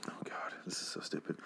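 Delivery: exasperated whisper; whispered aside. Real, not acted. Host to himself over a stupid story, exasperated whisper. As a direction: whisper it to yourself in disbelief.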